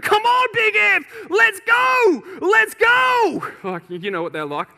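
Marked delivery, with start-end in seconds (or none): 0.0-3.4 high, full-force cheering yell; loud urging shout. Retold or imitated. Firefighters urge rower on around the machine, loud high shouting. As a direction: bellow them on, stretching each cheer. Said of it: "Sorry if that's too loud for you".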